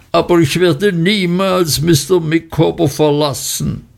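hoch hinaufgeschraubte Stimme, beteuernd; sich steigerndes Kreischen. Acted. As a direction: die Stimme schraubt sich in die Höhe. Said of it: "ihre Stimme zu lautem Kreischen gesteigert". (hoch kreischend beteuert, ohne laut zu werden)

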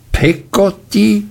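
aunt repeating the maid's name, indignant. A narrator's reading. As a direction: throw back Peggotty as an outrageous name. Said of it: "wiederholte Miss Betsy entrüstet".

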